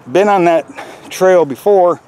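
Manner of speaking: ordinary talk, nothing marked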